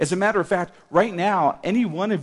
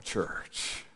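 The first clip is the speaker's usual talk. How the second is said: normal talking voice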